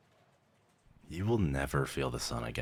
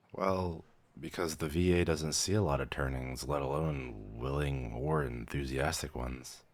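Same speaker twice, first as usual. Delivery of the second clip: normal talking voice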